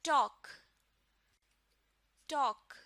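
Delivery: ٹاک said "tawk", no l, loud and clear; silent l. Shown as a demonstration. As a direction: repeat ٹاک with the l dropped. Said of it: "اس word کو پروناؤنس کریں گے".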